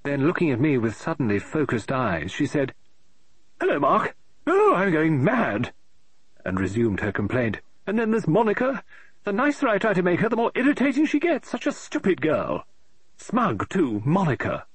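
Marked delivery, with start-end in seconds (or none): 3.6-5.7 high, fussy woman's voice, rising in exasperation; an eccentric lady author tearing her hair; fretting novelist. Acted. Mrs Oliver greets Mark. 7.9-14.0 high, fussy woman's voice, rising in exasperation; an eccentric lady author tearing her hair; fretting novelist. Acted. Mrs Oliver complains about Monica.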